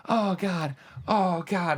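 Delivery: retold moan of pain, not full agony. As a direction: groan it out like you're hurt. Rolling around in pain.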